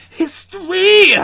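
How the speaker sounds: high, loud, slow proud cry; mock triumphant cry. Listeners boasting about the episode, a triumphant cry. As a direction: proclaim it grandly. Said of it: "I was part of history".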